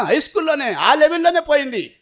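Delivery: ordinary talk, nothing marked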